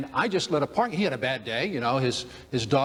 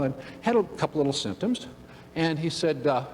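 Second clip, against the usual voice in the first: usual speaking voice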